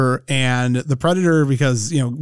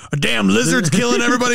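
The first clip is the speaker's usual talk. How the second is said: raised incredulous bark; action hero; 8 semitones higher, faster than usual. Retold or imitated. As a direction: shout it in disbelief, higher. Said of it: "Dylan's like".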